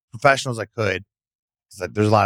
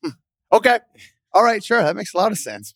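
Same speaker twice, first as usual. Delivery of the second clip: high, mock agreement; sarcastic; 12 semitones higher, 4 dB louder than usual. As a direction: pretend to agree, dripping sarcasm. Sarcastic reaction to bad reason.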